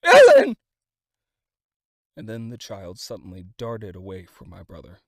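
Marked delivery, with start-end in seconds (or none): 0.0-0.5 high, loud shriek with tears; tearful shriek. A narrator's reading. Mother in crowd calls her daughter, tearful shriek. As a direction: scream for the lost child. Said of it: "shrieked a woman in the crowd".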